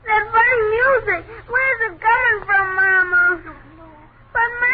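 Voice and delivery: loud, tearful cry to his mother; a scared boy shouting through tears; frightened crying child. Acted. Son waking to the music, crying out to his mother.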